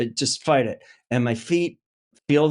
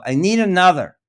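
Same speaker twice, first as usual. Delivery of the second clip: director calling out; 6 semitones higher, 6 dB louder than usual. Retold or imitated. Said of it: "I hear Janusz go".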